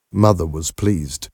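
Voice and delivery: plain reading voice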